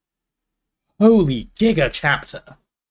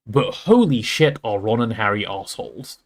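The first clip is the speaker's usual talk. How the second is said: usual speaking voice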